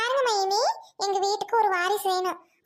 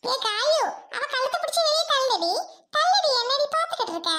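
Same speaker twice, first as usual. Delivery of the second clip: bossy shouting order; 6 semitones higher than usual. Acted. Mother-in-law orders daughter to evict.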